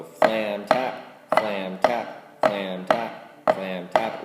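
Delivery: FLAM TAP stretched slowly, each word on a stroke; rhythmic sounding-out. Shown as a demonstration. Teacher voicing flam taps with the drum, "flam tap" slow and rhythmic.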